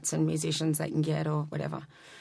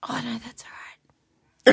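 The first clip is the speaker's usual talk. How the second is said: slow whispered brush-off; mock-humble whisper; whispered modesty; 4 semitones higher, much slower than usual. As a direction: whisper a shy, modest decline. Playing modest response.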